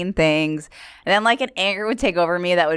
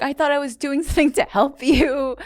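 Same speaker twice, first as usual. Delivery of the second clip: retold tearful complaint; 8 semitones higher than usual. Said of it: "She's just crying".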